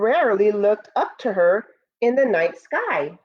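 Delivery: storybook narration. Acted. Teacher reading Moon's First Friends to students, storybook voice. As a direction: read it like a bedtime story for the class. Reads picture book aloud.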